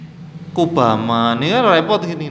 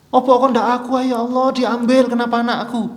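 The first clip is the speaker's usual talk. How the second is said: mock a grieving protest to God; protesting lament; 7 semitones higher than usual. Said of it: "protes kepada Allah".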